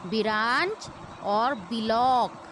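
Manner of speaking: "बिरांच" and "बिलॉक" each broken with an i after b; wrong pronunciation. Shown as a demonstration. Teacher imitating learners' mistake, split "बिरांच" and "बिलॉक". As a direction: say "बिरांच और बिलॉक" as bi-raanch, bi-lock. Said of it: "ये गलत pronunciation है".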